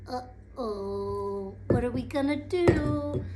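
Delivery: low, apprehensive uh-oh; mildly anxious storybook aside; hushed worry. Acted. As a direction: worry under your breath. Crowd freezes as bear sneezes.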